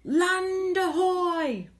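high, drawn-out lookout call; shout. A narrator's reading. Lookout in the crow's nest to the crew, long shout. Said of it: "a voice from the crow's nest shouted".

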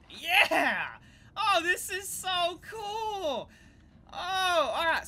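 high, slow, drawn-out gushing; whoop, then stretch out the delight; excited cheer. Real, not acted. Player celebrates what the game allows, excited.

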